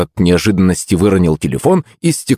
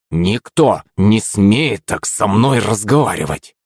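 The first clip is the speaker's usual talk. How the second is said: plain reading voice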